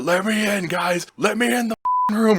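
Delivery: high acted shout through a door; demanding yell. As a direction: imitate someone yelling to be let in. Locked-out man demands entry, retold.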